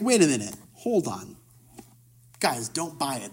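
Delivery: low, hushed aside to the others; sceptical crowd, confidential. Acted. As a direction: quietly urge your companions to hold back. Voices the sceptical murmuring crowd.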